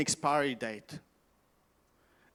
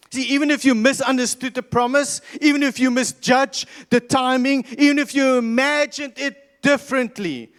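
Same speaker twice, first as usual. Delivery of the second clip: raised, louder preacher climax; preaching build; 6 semitones higher, 5 dB louder than usual. Acted. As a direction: build the sermon to a peak.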